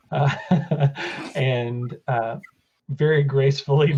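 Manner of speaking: ordinary talk, nothing marked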